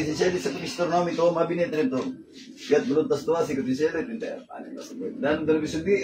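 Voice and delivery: soft, continuous incantation; recited Latin prayer. Real, not acted. Healer praying over the patient to dissolve illness, soft incantation. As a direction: recite a healing prayer in an unbroken murmured stream.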